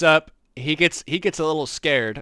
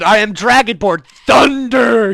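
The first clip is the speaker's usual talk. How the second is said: much louder, slow, thunderous proclamation; booming declaration; 7 semitones higher, 13 dB louder than usual. Retold or imitated. Player booming his dragonborn name at the barkeep, slow and loud. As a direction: boom it out like a grand dragonborn title. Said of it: "Like he said".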